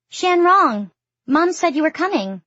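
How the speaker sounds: put-on high, loud child's squeal of delight; loud, high-pitched cry of welcome; excited squeal. A narrator's reading. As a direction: squeal it like a thrilled little boy greeting someone. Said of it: "the boy squeals in English".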